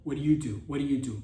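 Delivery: ordinary delivery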